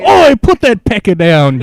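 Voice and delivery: loud, high British yell; hammed-up British telling-off; mock British accent. Podcaster plays outraged Victorian Brit, loud mock British accent. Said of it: "That's my British accent".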